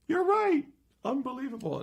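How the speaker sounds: high, loud astonished outburst; mock amazement. Magician conceding to kids he was wrong, mock amazed. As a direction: act floored by the discovery.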